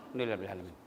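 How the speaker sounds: "Alhamdulillahirabbil alamin" swallowed into a quick soft mumble; mumbled rushed. Shown as a demonstration. Preacher mocks careless worshipper, quick soft mumble. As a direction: gabble "Alhamdulillahirabbil alamin" fast and low. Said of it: "Jangan kau bilang".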